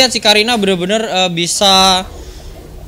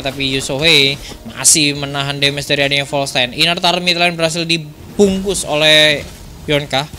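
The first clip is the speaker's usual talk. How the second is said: esports play-by-play; 3 semitones lower, 3 dB quieter than usual. Acted. Live esports play-by-play casting.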